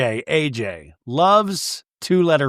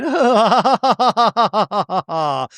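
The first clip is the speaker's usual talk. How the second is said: high, long fake society laugh; hammed-up posh laugh; 7 semitones higher, 3 dB louder than usual. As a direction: put on a grand, trilling laugh.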